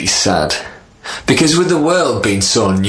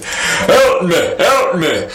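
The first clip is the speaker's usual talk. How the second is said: leopard crying for help; 9 semitones higher, slower than usual. Acted. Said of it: "the leopard cried".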